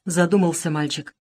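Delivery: ordinary delivery, nothing marked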